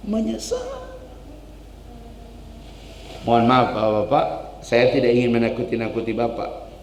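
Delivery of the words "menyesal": "Menyesal" diucapkan jauh lebih tinggi dan lirih; menyesal melengking tinggi. Shown as a demonstration. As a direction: ulangi "Menyesal" dengan suara tinggi melengking. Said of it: "Nanti kita akan menyesal". (kata "Menyesal" diulang tinggi dan pelan)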